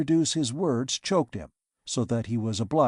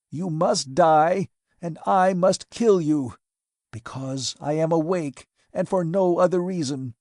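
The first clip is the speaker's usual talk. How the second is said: furious raised vow to kill; vengeful threat; 4 semitones higher, 4 dB louder than usual. A narrator's reading. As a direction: hurl the death sentence at her. Maskell threatening Thaidamen.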